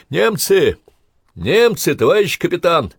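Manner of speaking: high, loud warning cry; urgent high shout of alarm. Acted. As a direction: call out the danger to your captain. Lookout warns captain of enemy attack.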